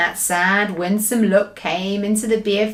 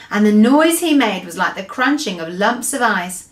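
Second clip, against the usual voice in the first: plain reading voice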